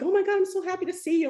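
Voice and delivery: fast, high happy exclamation; rushed delighted hello; excited greeting. Retold or imitated. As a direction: gush with joy at seeing them. Retelling women greeting her.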